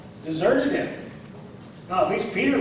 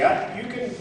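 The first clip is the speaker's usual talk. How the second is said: normal talking voice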